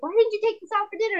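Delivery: fast, high mock scolding; nagging wife. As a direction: rattle off an exasperated complaint. Acted bickering with husband.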